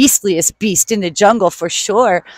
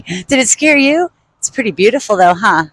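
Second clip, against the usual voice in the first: usual speaking voice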